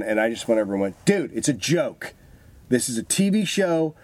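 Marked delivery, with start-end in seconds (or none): 1.1-3.9 higher, slow and soothing; calm the shaken guy down, it's only TV; reassuring reveal. Retold or imitated. Reveals the prank to victim.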